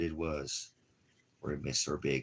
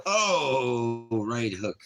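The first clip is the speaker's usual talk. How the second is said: surprised yelp; 7 semitones higher, 7 dB louder, much slower than usual. Real, not acted. Puppy paws him in play.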